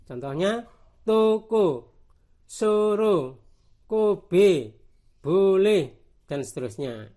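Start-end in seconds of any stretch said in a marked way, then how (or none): 1.0-5.8 loud, separated words with closed O in toko, soro, kobe, boleh; toko, soro, kobe, boleh with a close rounded O; closed O demonstration. Shown as a demonstration. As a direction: model the tight O of toko loudly, word by word. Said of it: "Itu enak dibaca O".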